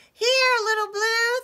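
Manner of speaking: very high, loud tiny-mouse shout; squeaky storybook call for help; crying out. Acted. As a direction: shout up to a rescuer. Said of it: "they all cried".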